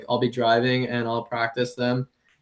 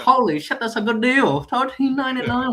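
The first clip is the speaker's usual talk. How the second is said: put-on high-pitched Chinese accent bit; 10 semitones higher than usual. Comic showing coworkers his old impression, high Chinese accent. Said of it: "a Chinese accent quite a lot".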